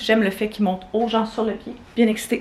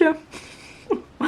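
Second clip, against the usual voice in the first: high giggle through numb lips; numb-mouthed laugh; 7 semitones higher, 3 dB quieter than usual. Real, not acted. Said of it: "Je veux pas capable de rire comme du monde".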